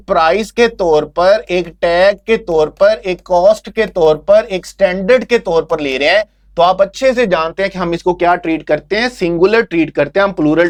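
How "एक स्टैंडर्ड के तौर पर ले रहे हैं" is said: ordinary talk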